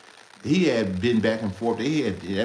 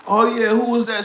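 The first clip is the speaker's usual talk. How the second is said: high, loud, eager question; eager drunk brother; 10 dB louder than usual. Retold or imitated. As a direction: jump in like the drunk brother who wants in.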